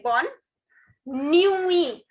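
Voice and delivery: bonne, pause, nuit ending on a long ee; silent final T. Shown as a demonstration. Teacher modelling good night in French, slow separate words. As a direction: say "nuit" as nwee, no t. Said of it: "The last T is silent".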